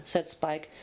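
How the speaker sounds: usual speaking voice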